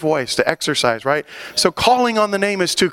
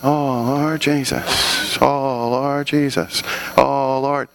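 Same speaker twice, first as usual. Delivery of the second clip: reluctant calling; 4 semitones lower, much slower than usual. Retold or imitated. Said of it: "And then I go".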